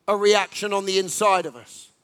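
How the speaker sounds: ordinary talk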